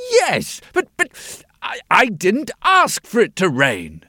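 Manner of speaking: high, loud stammering king; petulant royal splutter. Acted.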